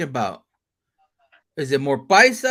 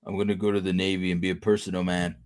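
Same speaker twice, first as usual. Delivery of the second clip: mock serious dork; 11 semitones lower, 4 dB quieter than usual. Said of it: "he was always serious, but, like, dorky".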